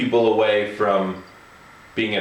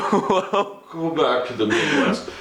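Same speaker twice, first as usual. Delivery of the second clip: dismissive put-down, laughing; 4 semitones higher, slower than usual. Retold or imitated. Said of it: "she looked at me and said".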